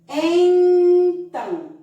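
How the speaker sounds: "Então" with En held very long, high and loud through the nose, then tão; slow, exaggerated nasal "Então"; stretched nasal syllable. Shown as a demonstration. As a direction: hum out a long loud En before finishing "Então". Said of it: "uma sílaba de som nasal".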